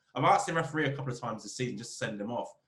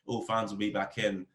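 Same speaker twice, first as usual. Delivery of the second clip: normal talking voice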